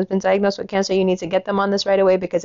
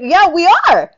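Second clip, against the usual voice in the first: high, loud angry rant; 7 semitones higher, 4 dB louder than usual. Real, not acted. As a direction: really lay into them. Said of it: "I told you I'm angry today".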